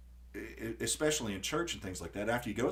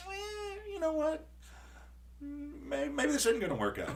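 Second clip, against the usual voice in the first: high squeaky put-on voice, much higher; whiny sing-song brush-off, pitched way up; a hammed-up voice for his own impatience; mock fed-up self. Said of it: "I'm like".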